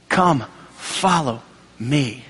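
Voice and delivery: slow, low, gentle invitation; a lightly voiced Jesus, slow and low; voice of Jesus calling. Jesus calls the disciples.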